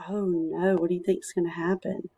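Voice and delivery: ordinary delivery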